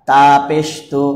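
usual speaking voice

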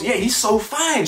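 high, slow, dreamy gush; mock swooning girl. Mocking her gushing over another man, dreamy and slow. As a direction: sigh it out like she's smitten. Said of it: "And she goes".

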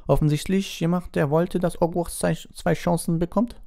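high, haughty headmistress; Madame Maxime. A narrator's reading. Madame Maxime objects to the judges, high and haughty.

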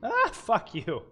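loud, high startled curse; startled shout. Real, not acted. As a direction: yell it out in shock. Jump scare reaction.

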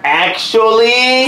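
high, loud, stretched-out objection; drawn-out protest. Real, not acted. Accused friend cuts in on his critics, drawn-out protest. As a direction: butt in high and slow, dragging the word out.

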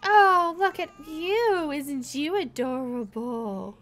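high, drawn-out gushing over a newborn; cooing at a baby. Pretend. Player gushing over the newborn baby, cooing.

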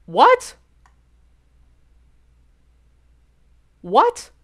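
real disbelief at a lucky guess; shocked disbelief. Real, not acted. Stunned by a lucky five K.

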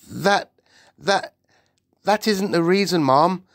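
high, halting, nervous voice; quivering maid. A narrator's reading. The maid tries to explain to the Queen, quivering. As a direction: stammer it out with anxious pauses. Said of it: "The maid's voice was quivering terribly".

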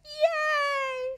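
very high, loud squeal; squeaky gleeful whoop; little boy's cheer. A narrator's reading. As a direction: cheer like a delighted small child. Little boy cheers for baby ride.

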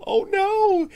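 high, loud fake gasp of fear; mock fright. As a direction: squeal like a frightened child.

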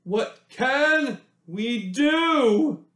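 slow, high, drawn-out plea; mayor asking. A narrator's reading. Mayor Muddlenut asking the villagers, slow high plea. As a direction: stretch each word like a baffled mayor. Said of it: "Mayor Muddlenut asked them all".

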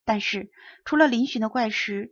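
ordinary delivery

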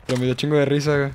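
usual speaking voice

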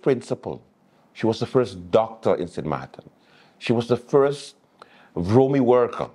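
rising, building sarcastic list; mock praise. Host mocking voters who snubbed Auntie Sarah, sarcastic. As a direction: pile it on with irony.

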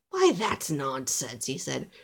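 low, slow dismissal; old elf. A narrator's reading. Old elf reassuring little Alistair, firmly dismissive. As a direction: draw it out low, dismissing the worry. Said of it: "The old elf interrupted".

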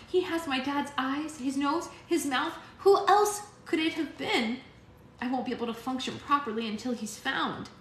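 put-on stubborn girl, near tears; young girl voice. A narrator's reading. Alex insisting about her dad.

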